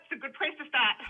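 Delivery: ordinary talk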